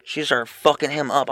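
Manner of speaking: normal talking voice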